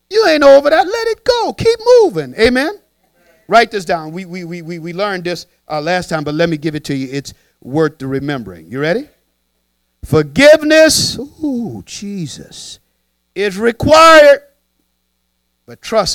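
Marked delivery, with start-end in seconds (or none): 0.1-2.8 high, loud pulpit exhortation; preaching. Acted. Pastor urging the church to let it go, high and loud. 10.0-14.4 slow, loud, drawn-out proclamation; preaching. Acted. Pastor proclaiming to the congregation, slow and loud.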